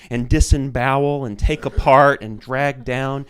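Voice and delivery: slow, loud and rising mock rant; mocking zealot rant. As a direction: ham up a fanatic reciting slogans. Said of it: "And they were saying".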